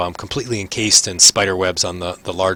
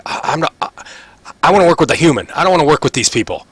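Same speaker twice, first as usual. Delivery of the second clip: lightly voiced complainer, pitched higher; higher-pitched mild protest; protesting Ront; 7 semitones higher, 4 dB louder, faster than usual. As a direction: dig in and refuse, voice pitched up. Game master voices protesting Ront.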